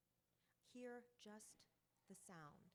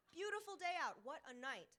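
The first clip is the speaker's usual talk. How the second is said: laughing stock phrase; 6 semitones higher, 8 dB louder than usual. Retold or imitated.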